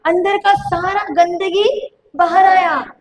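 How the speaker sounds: a preacher's rising, shouted challenge; preaching at full force. Acted. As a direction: drive the point home to the congregation, slowly.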